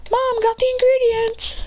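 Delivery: high, sustained call to Mom; acted holler home. Character calls out to mom at home.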